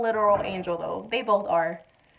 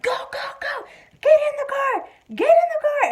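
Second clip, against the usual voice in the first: mock frantic yell, much higher pitch; frantic urging; 5 dB louder than usual. Husband rushing wife into the car, frantic mock yell. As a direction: imitate a panicked high-pitched shout.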